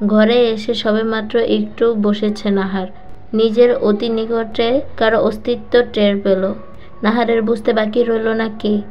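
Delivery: ordinary delivery, nothing marked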